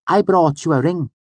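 high, loud, dainty voice; Auri. A narrator's reading. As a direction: announce a gift in a girlish high voice. Auri presents her gift.

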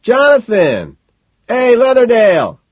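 loud, high shout across a crowd; calling out. A narrator's reading. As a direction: call out over the crowd. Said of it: "I call out to him".

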